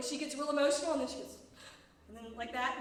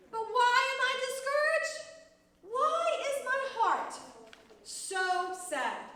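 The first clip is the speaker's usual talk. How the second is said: higher, louder, drawn-out lament; anguished questioning; 7 semitones higher, 5 dB louder, slower than usual. Acted. Teacher reading David's discouraged psalm, anguished pleading. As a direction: plead it high and slow, stretching the why.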